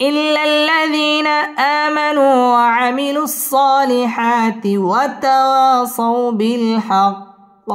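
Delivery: slow, drawn-out melodic recitation; Quran recitation. Shown as a demonstration. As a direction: chant it slowly, tajweed style. (ধীর সুরেলা কুরআন তিলাওয়াত)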